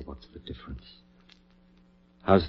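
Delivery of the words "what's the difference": barely breathed, dismissive; whispered shrug. Acted. Surgeon brushing off his colleague's doubt, whispered. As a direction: brush the question off under the breath. Dismissive aside during experiment.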